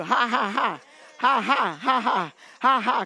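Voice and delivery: spoken-out, emphatic ha-has; preacher's prompting laugh; deliberate laughter. Shown as a demonstration. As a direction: laugh it out on purpose, beat by beat. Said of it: "Laugh, Monica".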